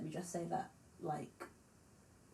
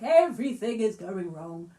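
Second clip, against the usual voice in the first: big overwrought rock wail; dramatic; 9 dB louder than usual. Shown as a demonstration. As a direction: belt it like a melodramatic rock singer. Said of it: "being dramatic like Muse".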